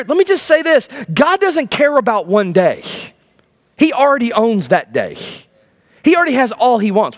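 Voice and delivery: normal talking voice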